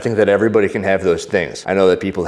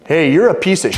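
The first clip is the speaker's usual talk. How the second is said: raised-pitch, blunt acted insult; retold confrontation; 8 semitones higher, 3 dB louder than usual. Man confronting rude woman at security, mildly acted insult. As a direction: act out calling someone out, pitched up.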